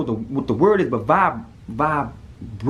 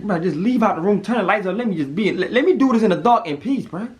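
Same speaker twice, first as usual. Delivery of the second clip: desperate plea; 8 semitones higher, 3 dB louder than usual. Retold or imitated. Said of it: "I'm like".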